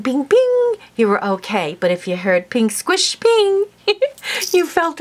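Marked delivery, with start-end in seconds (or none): 0.0-0.7 high, loud pinging bullet noises; playful sound effect of bullets pinging off metal; mock bullet pings. Imitating bullets hitting the plane. 2.7-3.7 a high squish, then a loud high ping; mock bullet squish and ping. Imitating a bullet passing through someone.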